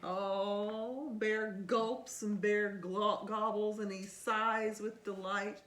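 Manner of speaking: put-on lively read-aloud to a child; storybook reading. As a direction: read it like bedtime story time. Reading picture book aloud.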